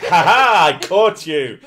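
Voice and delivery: loud mock gloating laugh-speech; loud, smug mock triumph; triumphant gotcha. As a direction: crow over catching him out. Voicing triumphant girlfriend.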